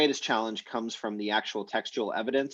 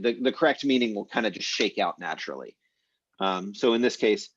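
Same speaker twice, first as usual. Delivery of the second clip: ordinary delivery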